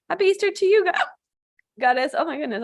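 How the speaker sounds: talking through hiccups; words broken by hiccups. Real, not acted. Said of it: "I don't know how I got the hiccups".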